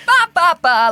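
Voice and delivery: high, loud babbling imitation of a chatty repairman; loud mocking ba-ba chatter; mock phone chatter. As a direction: ham up his carefree yapping on the phone.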